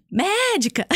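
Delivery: imitating a hopeful guesser, pitched high; mock excited call-out, high; eager guess. Stranger guesses her job a third time, eager guess. Man calls out another guess.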